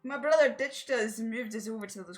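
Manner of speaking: put-on a light Scottish accent for the villager. Villager complains about her brother, light Scottish accent. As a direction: roll the lines in a mild Scottish accent. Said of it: "put on my good Scottish accent".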